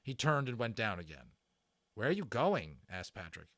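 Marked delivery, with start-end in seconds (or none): none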